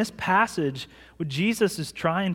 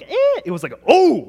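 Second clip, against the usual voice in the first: high, loud startled yelp; mock startled yelp; 10 semitones higher, 11 dB louder than usual. Pastor reenacting his fright at the mouse, high yelp. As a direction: squeal like a man spooked by a mouse. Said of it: "it wasn't like a girl".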